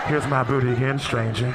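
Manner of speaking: low-pitched awkward heads-up; awkward announcement. Acted. Passenger passing a stranger's seat, low awkward announcement. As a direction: announce it low to the person you squeeze past. Passenger squeezing past again.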